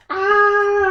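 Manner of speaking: a sudden high, loud yelp of alarm; startled cry. Acted. Narrator voicing a child's startled yelp, high and loud. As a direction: yelp out like the crash just happened. Character cries out in story.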